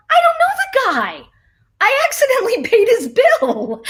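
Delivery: high, loud protest breaking into a laugh; retold disbelief, pitched high and loud; exasperated protest. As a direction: plead it up high, laughing at the mess. Retold protest to the electricity guy.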